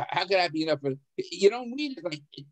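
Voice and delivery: usual speaking voice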